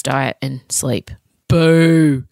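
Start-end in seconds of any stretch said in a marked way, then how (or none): 1.5-2.2 loud playful boo; mock booing. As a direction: boo at the bad news. Booing her own advice.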